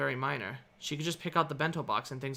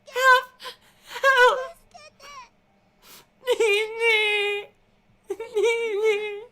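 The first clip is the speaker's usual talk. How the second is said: high, loud frightened cries; terrified pleading; 11 dB louder, much slower than usual. A narrator's reading. Trembling girl calling her brother. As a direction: cry out in terror, high. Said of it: "trembled while looking up at me".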